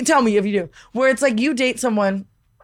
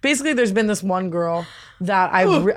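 usual speaking voice

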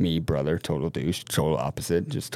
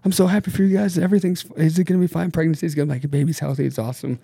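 gushing sister; 8 semitones higher, faster than usual. Retold or imitated. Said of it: "And she's like".

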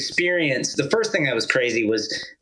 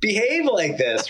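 bewildered; 10 semitones higher than usual. Retold or imitated. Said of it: "I'm just like".

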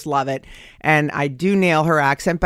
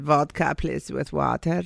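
put-on lower, soft Eastern European accent; Anka; 4 semitones lower, 5 dB quieter than usual. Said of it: "I went in full character".

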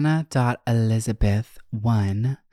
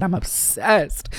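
voice cracking; 8 semitones higher, slower than usual. Real, not acted. Gushing about a show as her voice cracks. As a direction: voice cracks up high, drawn out. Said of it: "not my voice cracking".

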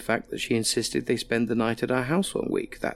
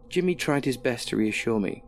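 ordinary delivery, nothing marked

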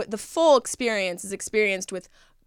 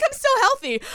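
high, cheery fake health voice; mock cheery voice; 13 semitones higher than usual. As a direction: sell it like a wellness nut. Mocking healthy self.

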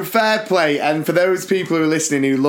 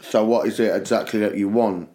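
lower, wary mock Cockney John; lower, flat Cockney John; 6 semitones lower than usual. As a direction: play the suspicious mate on the phone. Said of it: "he just was like this on the phone".

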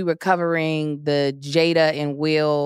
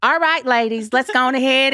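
lightly Southern audition read; Southern accent; 7 semitones higher, 3 dB louder than usual. Retold or imitated. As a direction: slip into a Southern accent, stuck on it. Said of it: "one more time without the accent".

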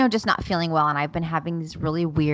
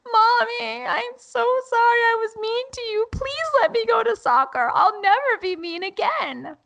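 mock toddler wail, very high and louder; Matilda; 4 dB louder than usual. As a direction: mimic a tearful little girl begging forgiveness. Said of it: "starts crying".

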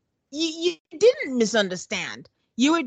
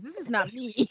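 usual speaking voice

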